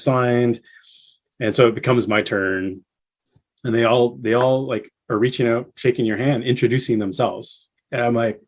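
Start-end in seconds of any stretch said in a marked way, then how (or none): none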